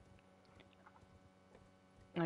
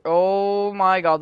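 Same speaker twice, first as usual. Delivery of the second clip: slow, loud shocked gasp; stunned and loud; shocked exclamation; 5 semitones higher, 9 dB louder, much slower than usual. Real, not acted. Two bosses appear.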